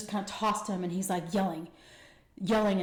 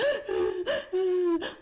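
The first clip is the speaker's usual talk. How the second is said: dramatic gasp; 11 semitones higher, 3 dB louder than usual. Retold or imitated. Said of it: "like that".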